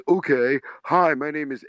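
high, loud eager start; eager heckler. Retold or imitated. Heckler on stage starting his camera speech, giddy and loud. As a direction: rush into it like a giddy fan. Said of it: "And the guy goes".

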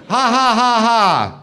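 high, loud fake "ha ha ha"; sarcastic written laugh voiced big; mock laughter. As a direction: read the laugh out as a jeering cackle. Reading a mocking email laugh.

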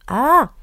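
sharp, high, loud exasperated "ah"; a burst of annoyance at herself; frustrated outburst. Real, not acted. Host flubs her outro and cries out in frustration.

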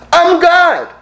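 high, slow proud landowner; lightly voiced boastful land owner; gruff scottish lord. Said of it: "this is what follows".

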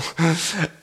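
brief amused laugh; a quick laugh at the joke; short laugh. Real, not acted. Joke about the last question.

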